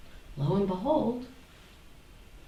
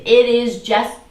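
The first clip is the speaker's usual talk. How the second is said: mock certainty; 5 semitones higher, 8 dB louder, slower than usual. Mimicking the sure voice.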